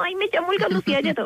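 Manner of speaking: high, hammed-up simpering voice; wrong timid tone. Shown as a demonstration. Showing how not to give her name, exaggerated weak tone. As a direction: say it high and unsure, as a parody. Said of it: "No, o sea, lo digo en un tono".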